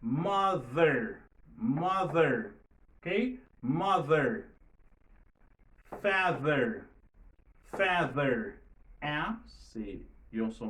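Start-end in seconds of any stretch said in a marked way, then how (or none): 0.0-2.5 "Mother" with a buzzing voiced th, loud. Shown as a demonstration. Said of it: "li sonore". 3.6-8.6 "Mother", "Father" with a voiced th. Shown as a demonstration. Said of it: "li sonore".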